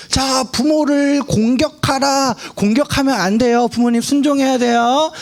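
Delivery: put-on high, slow, preachy children's sermon voice; mock kiddie sermon. Preacher mocking a children's-level sermon, high and slow. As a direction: ham up a simple children's preacher. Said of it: "이러고 설교가 끝나면 돼요".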